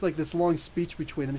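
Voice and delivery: usual speaking voice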